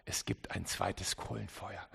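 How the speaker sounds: soft, low announcement of the sermon title; quiet title line. Shown as a demonstration. Preacher quietly naming his sermon, soft and low. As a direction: let the title drop almost to a murmur. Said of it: "deswegen heißt meine Predigt auch".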